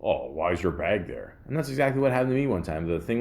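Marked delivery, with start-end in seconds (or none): 0.0-1.4 lower, softer mock whine; a dismissive mock of the rude passenger; whiny complainer quote. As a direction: imitate a petty complainer, lower and quieter. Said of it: "say something like".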